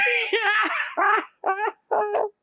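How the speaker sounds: high shrieking belly laugh; a boy cracking up; helpless laughter. A narrator's reading. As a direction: laugh till you roll over. Oliver rolling on the ground.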